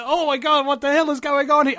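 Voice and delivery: loud, high mock panic; hammed-up horror; retold alarm. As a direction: act out a shocked double-take. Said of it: "and you're like".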